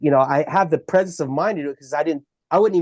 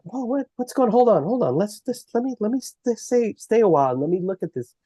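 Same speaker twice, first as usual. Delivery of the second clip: higher, surprised hold on; surprised protest; 5 semitones higher than usual. Retold or imitated. Dad protesting as family urges leaving, surprised and higher. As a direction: stall them, curious and taken aback. Wants to stay, pleads to look.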